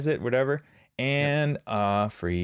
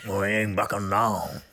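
Ed Ogeron impression. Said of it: "one of my best impersonations".

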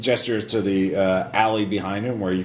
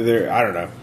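ordinary talk, nothing marked

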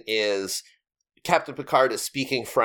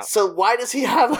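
exasperated outburst; 10 semitones higher than usual. Real, not acted. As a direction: burst out in disbelief. Exasperated question about Picard's accent.

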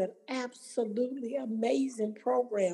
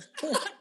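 real high laugh; 8 semitones higher than usual. Real, not acted. Laughing at Regina's surprise call.

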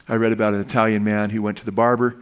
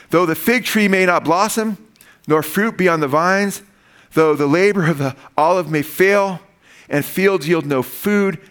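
slow, raised reading of the verse; scripture reading; 4 semitones higher, slower than usual. Acted. Pastor reads verses to the congregation, slow and raised. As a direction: read the verse slowly and with weight.

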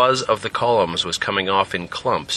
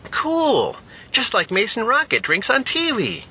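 put-on much higher, eager child; excited kid; 14 semitones higher than usual. Child thrilled about a root beer treat, high and eager. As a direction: squeal it like a happy kid.

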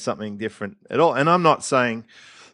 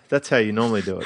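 ordinary talk, nothing marked

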